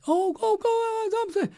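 gushing, high and happy; all happy. Retold or imitated. Shop lady thrilled to be given the card, happy. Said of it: "She was all happy".

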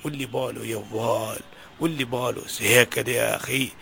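low, soft complaint of hardship; a tired man pouring out his troubles; weary lament. Acted. Father confessing money troubles to his friend, low weary lament.

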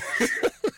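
high, breathy burst of laughter; laughing off the caller's attempt. Real, not acted. Host laughs.

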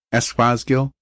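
usual speaking voice

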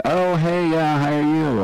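his own reply. Retold or imitated. Rick greeting the fan, lightly acted. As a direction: replay his polite surprised hello. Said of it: "And I went".

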